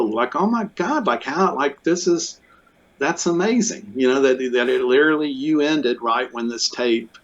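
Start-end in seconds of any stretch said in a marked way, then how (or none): 0.4-3.9 high-pitched, awestruck exclamations; retold amazement, pitched high; amazed. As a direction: gush with disbelief. Retelling his amazed reaction.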